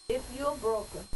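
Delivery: normal talking voice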